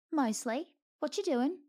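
high, chirpy ferret voice. A narrator's reading. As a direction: chirp the question brightly.

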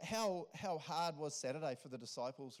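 ordinary talk, nothing marked

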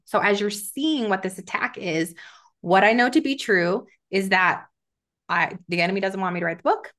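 ordinary delivery

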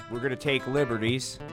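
ordinary delivery

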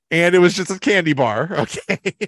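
real giggles breaking into the line; laughing. Real, not acted. Amused by the candy bar joke, laughing as they talk.

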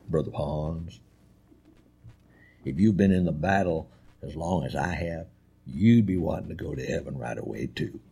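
very low, soft, weighty elder voice; old Tennessee evangelist. Retold or imitated. Old evangelist counseling a young student, low and soft. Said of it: "that's how they talk".

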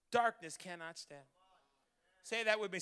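ordinary talk

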